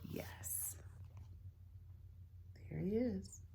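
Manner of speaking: soft whispered yes. Real, not acted. Sister answering her brother, a soft whisper. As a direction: barely whisper it.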